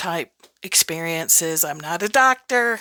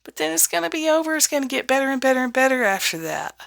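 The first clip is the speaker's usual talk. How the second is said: higher and quicker, cheering them on; upbeat and bright; 6 semitones higher, faster than usual. Acted. Coach to a scared beginner, high and fast with cheer. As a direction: lift the voice into encouragement. Turns cheerful after the warning.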